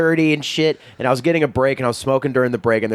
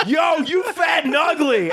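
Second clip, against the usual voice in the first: high, slow shouted insult through a laugh; 12 semitones higher than usual. Retold or imitated. Line cook retelling the driver's insult, laughing shout. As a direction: holler it gleefully. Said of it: "smiling and he was like".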